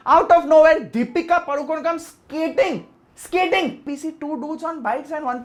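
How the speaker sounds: stunned, mocking disbelief; incredulous disbelief. Real, not acted. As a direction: sell how absurd the scene is. Incredulous retelling of the absurd scene.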